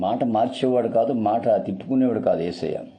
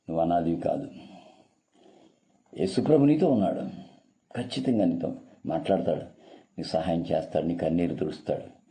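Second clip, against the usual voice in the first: soft divine comfort; 4 semitones lower, 4 dB quieter than usual. Acted. God's spirit consoling the listener.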